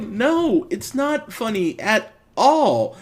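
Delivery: high-pitched, emphatic refusal; frustrated rejection. Retold or imitated. Reviewer answers the unfunny gag, frustrated. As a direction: push back firmly, pitch held high.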